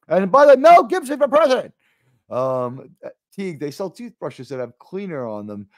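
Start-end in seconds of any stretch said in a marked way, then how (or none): 0.1-1.7 loud, high rallying cry; big, high comic proclamation; mock campaign shout. Mock campaign call for actor.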